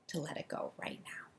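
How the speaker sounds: breathy whisper, letting go; soft guided whisper; whispered release. Shown as a demonstration. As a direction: whisper it as you let go. Calm release, softly guided.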